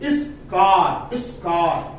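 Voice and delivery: इस कार said very slowly, syllable by syllable; slow demo. Shown as a demonstration. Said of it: "एस को हम इस पढ़ेंगे".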